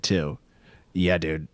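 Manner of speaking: normal talking voice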